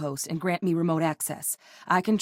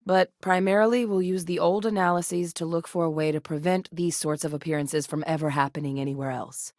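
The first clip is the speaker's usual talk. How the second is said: ordinary talk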